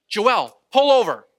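loud, high command; acting out an urgent order; retold urgent call. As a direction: call out sharply to the driver. Wife calling out as tire blows.

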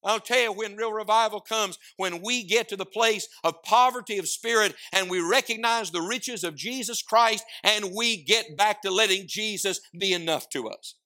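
loud, high, fervent preaching. Acted. Preacher crying out to the congregation, loud and high. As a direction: preach it to the rafters.

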